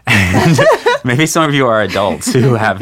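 laughing through the words; giggling while talking to listeners. Real, not acted. Laughs mid-sentence.

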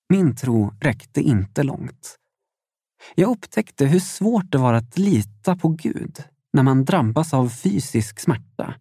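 usual speaking voice

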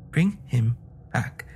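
slow, spaced-out plea; anguished cry. A narrator's reading. Old woman pulling husband to door, crying out. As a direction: draw out each word as a plea. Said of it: "cried the old woman".

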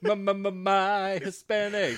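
slow, drawn-out mocking echo; mocking repeat. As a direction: stretch each my, teasing. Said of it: "it's weird for somebody to just say".

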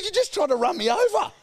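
very high, loud retold protest; indignant accusation. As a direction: yell it back in acted outrage. Retold accusation in roadside quarrel.

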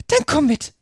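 high-pitched queen's roar, understated; bellowed. A narrator's reading. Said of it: "brüllte die Königin". (Gebrüll der Königin, hohe Stimme)

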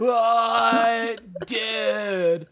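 stoner. Pretend. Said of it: "nineties television high".

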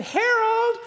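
high, loud yell of a name. Retold or imitated. Wife yelling for her husband into the men's bathroom, loud high shout. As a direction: call him out sharply across the room. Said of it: "she stands by the doors and said".

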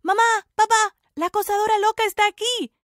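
yelling for his parents; shouting. A narrator's reading. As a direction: call out to mom and dad through the house. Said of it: "gritó".

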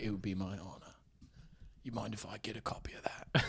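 soft, whispery British impression; hushed, polite British star; Jason Statham. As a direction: ask it almost in a whisper, close to the mic. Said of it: "whisper it a little bit".

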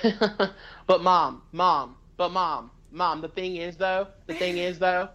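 drawn-out imitation of Janelle's accent. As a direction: put on Janelle's accent, pleading with Mom. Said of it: "that's a good Janelle accent".

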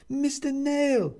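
high, slow drawn-out call. A narrator's reading. Neighbour calling for him from the hallway, drawn out. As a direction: call his name through the door.

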